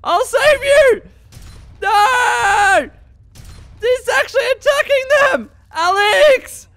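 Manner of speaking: very high, loud desperate calls; panicked shouting. Real, not acted. Gamer calling a companion under attack, panicked shouting. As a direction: yell for your companion.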